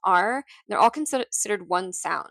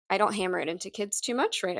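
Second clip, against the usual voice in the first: ordinary talk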